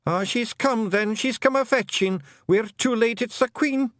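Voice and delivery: high, loud Scots dismay; a panicked little clansman; alarmed Feegle. Acted. The chief fearing the Queen has come for them.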